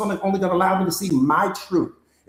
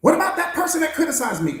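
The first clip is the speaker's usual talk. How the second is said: higher, complaining voice of a wounded student; hurt student; 8 semitones higher than usual. Acted. Coach voicing a student who was left, higher and complaining. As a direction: plead your hurt to the coach.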